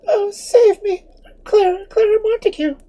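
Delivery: very high, crying plea for rescue; frightened girl pleading with the priest; desperate cry. Acted. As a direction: sob it out, begging at his feet. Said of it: "she exclaimed".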